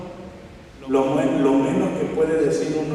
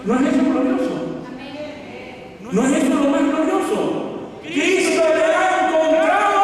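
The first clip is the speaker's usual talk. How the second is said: high, rising cries of wonder; exultant preaching; 10 semitones higher than usual. Real, not acted. Preacher asking the congregation to rejoice, high exultant proclamation. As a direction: lift it high and hold the last word.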